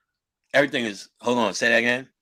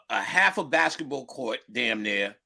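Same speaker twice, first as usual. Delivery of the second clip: normal talking voice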